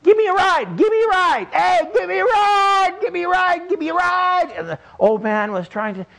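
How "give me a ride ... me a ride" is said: put-on high, loud child's yell, over and over; boy yelling. Small boy yelling at the passing steamboat, high and loud. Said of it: "the little boy starts yelling".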